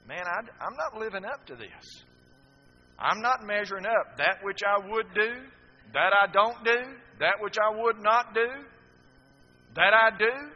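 a guilty man crying out his failure; anguished inner cry. Retold or imitated. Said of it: "I kept thinking".

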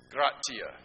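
Gratia with a t in place of the s; a clear t in the middle of Gratia; Spain-style gracias. Shown as a demonstration. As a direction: say "Gratia" as grah-TEE-ah. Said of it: "It's not a C, it's a T".

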